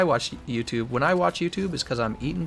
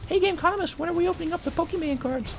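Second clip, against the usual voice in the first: much higher squeaky fan voice; impatient viewer voice. Acted. Streamer voicing a fan pestering him, high squeaky voice. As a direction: pipe up like an eager kid in chat.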